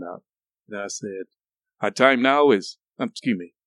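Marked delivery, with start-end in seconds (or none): 1.8-2.8 loud time check in an adult voice; grown-up radio announcer. Retold or imitated. Radio host starts a time check, grown-up announcer voice. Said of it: "this is why I sounded".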